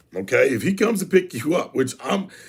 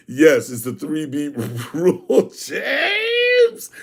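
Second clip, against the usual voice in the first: laughing call; much slower than usual. Real, not acted.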